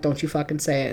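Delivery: hushed mom threat; quiet warning. Retold or imitated. Mother silently warning her daughter not to complain, hushed. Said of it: "she would look at her like".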